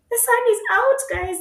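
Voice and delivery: very high, loud squeal of joy; excited. Real, not acted. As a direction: squeal it out with delight. Thrilled about sunshine.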